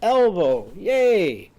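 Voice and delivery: loud high cheer for a vowel word. Shown as a demonstration. Teacher showing kids how to cheer, loud excited cheer. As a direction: cheer it out like a class celebrating.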